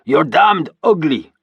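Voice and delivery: loud, slow jeer; mocking shout. A narrator's reading. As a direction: taunt him for a laugh. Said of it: "shouted a beefy warrior".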